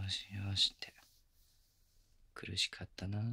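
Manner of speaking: soft breathy whisper close to the ear. Acted. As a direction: whisper it tenderly. Gentle whispered comfort.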